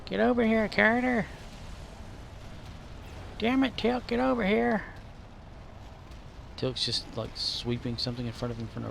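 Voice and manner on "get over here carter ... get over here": impatient yelling. Pretend. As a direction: holler at your squad to come over. Yelling orders at game squad.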